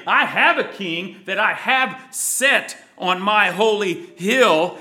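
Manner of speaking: loud and triumphant decree; proclaimed decree. Acted. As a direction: declare it as a king on his hill. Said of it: "and in wrath saying".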